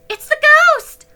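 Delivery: high, loud frightened blurt; terrified girl blurting; blurted cry. A narrator's reading. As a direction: cry it out before she can stop. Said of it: "little Girlie blurted".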